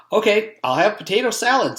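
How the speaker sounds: put-on high, bright kid voice; a boy settling for the only food; light high child voice. Joe orders lunch.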